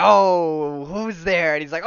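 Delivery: mock menacing villain. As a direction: play the villain finding prey, hammed-up. Carnage discovers someone, retold.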